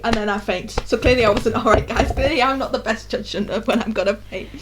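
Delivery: ordinary talk, nothing marked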